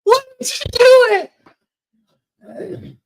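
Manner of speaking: high, loud wailing cry; hammed-up crying victim. Hit girl wailing up at the driver, high and loud. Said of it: "she goes".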